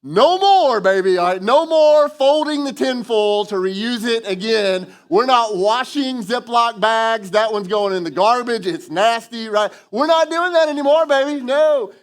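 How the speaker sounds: loud, high, emphatic mock parent scolding; parent putting foot down. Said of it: "you were like".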